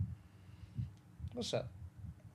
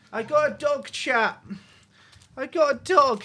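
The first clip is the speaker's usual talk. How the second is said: bright eager announcement, louder and higher; excited; 5 semitones higher, 8 dB louder than usual. Real, not acted. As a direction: proudly show off the new dog to chat. Excited to show dog to chat.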